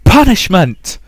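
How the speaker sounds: a mother's deafening shriek of rage; furious shout. Acted. Mother screaming at terrified Rosa, furious shout. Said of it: "She'd never heard Mother this loud before".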